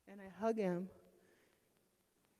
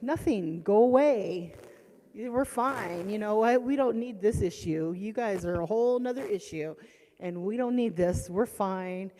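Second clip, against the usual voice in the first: loud, forceful brush-off; loud dismissal; 2 semitones higher, 11 dB louder than usual. Retold or imitated. Mother sending the police away, loud. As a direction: send the cops away hard. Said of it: "I'm gonna be like".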